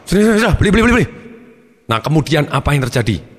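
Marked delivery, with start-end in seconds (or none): 0.0-1.1 higher, flustered giving-in; mock panicky dad caving in; embarrassed father. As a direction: give in hastily to stop the scene. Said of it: "Papanya malu".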